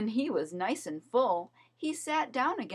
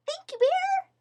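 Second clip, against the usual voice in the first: very high, squeaky thanks; a tiny owl squeaking thanks; high little owlet voice; squeaky baby owl; 7 dB louder than usual. A narrator's reading. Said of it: "squeaked the baby owl".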